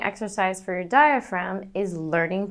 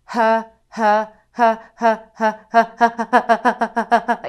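slow breathy ha pulses pushed from the belly; slow panted ha; 4 dB louder than usual. Shown as a demonstration. Voice teacher showing a slow diaphragm panting ha. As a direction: pant each ha like a gentle laugh on the note. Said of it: "So start slowly".

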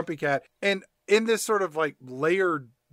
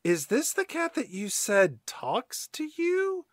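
skeptical slow voice; 8 semitones higher, 4 dB quieter, slower than usual. Acted. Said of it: "Tabby is like".

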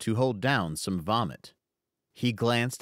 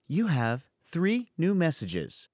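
higher, slow automated phone voice; robot voice reading the greeting; robotic voicemail voice; 7 semitones higher than usual. Acted. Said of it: "the robotic feminine voice said".

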